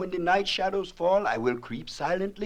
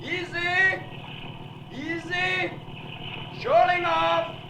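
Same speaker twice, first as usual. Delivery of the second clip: higher, slow calls guiding the boat in; calling out directions; 8 semitones higher, slower than usual. Acted. King guides Slade's boat past the shoals, calling out. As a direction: call the boat through the shoals, drawn out.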